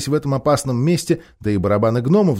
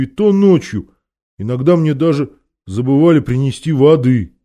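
donkey's voice; 3 semitones higher, 4 dB louder than usual. A narrator's reading. The donkey excusing himself to the king, in a donkey's voice. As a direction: apologise as a simple donkey, voice pushed up. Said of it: "послышался голос осла".

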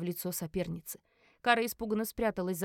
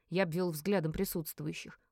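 plain reading voice

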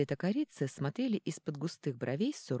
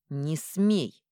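ringing, louder cry; 6 dB louder than usual. Acted. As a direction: ring it out like a bell. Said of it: "звенящим голосом сказала та".

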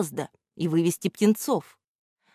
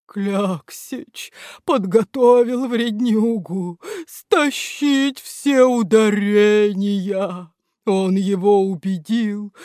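slow, lowly voiced giant statue, tearful; a sorrowful huge statue explains; weeping statue; 3 semitones higher, 3 dB louder, slower than usual. The statue explains the theft to Грамотейка, sorrowful.

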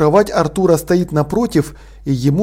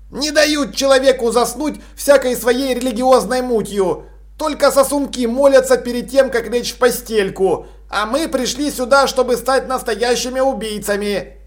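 put-on high, grumbling boy's voice; pitched-up, peevish character voice; complaining dormitory boy; 7 semitones higher than usual. A narrator's reading. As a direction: grumble about the pious nonsense.

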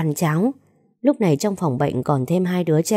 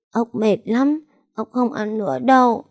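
put-on high, whiny little boy on the verge of tears; whimpering child; 6 semitones higher than usual. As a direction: pout and whimper like a sick toddler. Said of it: "mếu máo lắc đầu". (giọng trẻ con mếu máo, cao)

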